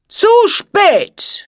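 high, loud, venomous hiss. A narrator's reading. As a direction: spit it out at the fairies. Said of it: "zischte er".

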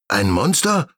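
high astonished question; astonished hedgehog. Acted. Hedgehog asking in surprise about the monster. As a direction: gasp out the question. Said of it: "fragt Ibo Igel erstaunt".

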